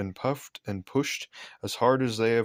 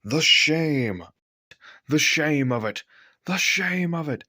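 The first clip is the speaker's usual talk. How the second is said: high, louder wail of disgrace; indignant; 6 semitones higher, 4 dB louder than usual. A narrator's reading. Gordon lamenting his goods train, high and indignant. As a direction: moan it like a wounded pride.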